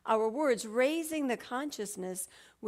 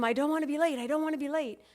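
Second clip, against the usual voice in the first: higher, louder worried self-talk; mock fretting; 5 semitones higher, 5 dB louder, much faster than usual. Acting out old self-talk about running late, mock fretting. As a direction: imitate fretful, urgent repeating to yourself.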